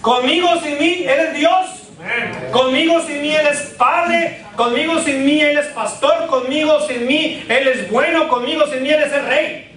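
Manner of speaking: high-pitched rhythmic preaching refrain; fervent preaching. Real, not acted. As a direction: drive each phrase home to the congregation. Preacher builds a rousing refrain.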